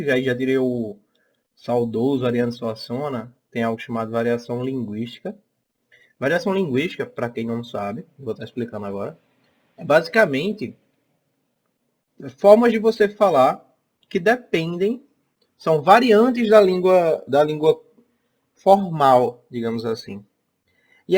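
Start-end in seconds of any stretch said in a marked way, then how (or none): none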